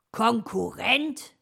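loud, drawn-out questioning rise; baffled. A narrator's reading. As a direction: echo the word in slow disbelief. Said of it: "war Herr Fuchs verblüfft".

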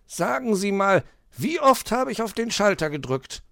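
fox asking. Acted. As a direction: ask as the worried fox. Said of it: "erkundigte sich der Fuchs".

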